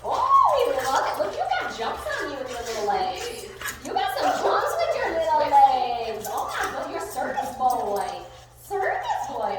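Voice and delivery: loud, high playful cooing; gushing dog talk. Real, not acted. Gushing over dog in play.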